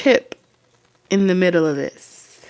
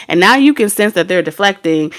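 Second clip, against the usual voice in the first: ordinary talk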